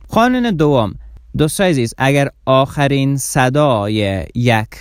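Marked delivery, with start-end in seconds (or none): none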